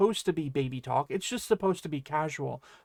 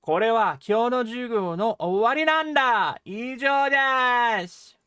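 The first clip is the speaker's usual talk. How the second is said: loud, high announcement, stretching "owari nanda" and "Ijou desu"; strong emphasis on owari nanda; 5 semitones higher, 6 dB louder than usual. Shown as a demonstration. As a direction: proclaim it big, ending on a long high "Ijou desu". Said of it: "puts a much stronger emphasis on that noun".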